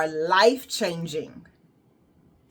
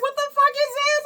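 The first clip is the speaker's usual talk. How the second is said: high, fast panicked outburst; reliving the freak-out in a squeal; mock panic, pitched way up; faster than usual. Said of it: "I was like".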